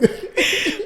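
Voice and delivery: airy high giggle; high breathy laugh. Real, not acted. Husband laughing at his own story, high breathy giggle.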